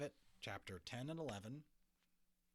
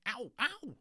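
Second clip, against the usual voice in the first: high, loud pained yelps; pained boy yelps; shouting ow; 9 dB louder than usual. A narrator's reading. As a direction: hop about yelping. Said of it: "Omri dancing about shouting".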